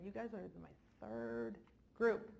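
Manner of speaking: usual speaking voice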